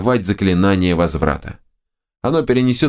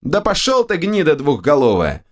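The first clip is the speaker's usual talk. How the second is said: high, sharp snap at the ogre; snapping back; 8 semitones higher than usual. A narrator's reading. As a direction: bite the words off in angry retort. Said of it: "огрызнулся я в ответ".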